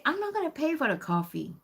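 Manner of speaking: high-pitched mock rude refusal. Member refusing to pay for coffee, rudely. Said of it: "she was really rude. And she said".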